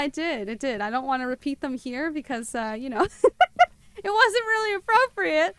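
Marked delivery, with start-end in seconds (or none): 3.1-5.5 laughing. Real, not acted. Laughing after embarrassing caption.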